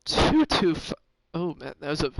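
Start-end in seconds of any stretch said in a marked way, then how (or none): 0.0-0.7 voice crack. Real, not acted. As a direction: voice cracking high and loud. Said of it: "that was a nice little voice crack there".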